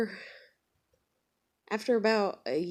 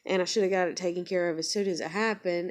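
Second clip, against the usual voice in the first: usual speaking voice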